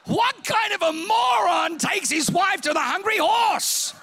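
high, loud, slow incredulous shout; self-mocking shout. Real, not acted. Pastor ridiculing himself to the congregation, shouting. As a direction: yell it at your own stupidity.

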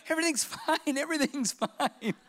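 nervous cover-up. Retold or imitated. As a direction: pretend nothing is wrong, badly. Joseph covering panic.

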